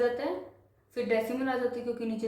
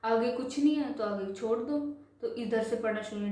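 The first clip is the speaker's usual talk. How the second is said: ordinary talk, nothing marked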